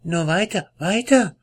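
high, pushing urgency; impatient wife. Acted. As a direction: hurry him on impatiently. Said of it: "sagte seine Frau".

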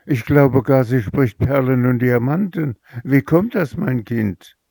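amazed mother. Acted. The mother asks her daughter how this happens, amazed. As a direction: pitch climbs on Wie kommt das. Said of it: "rief die Mutter erstaunt".

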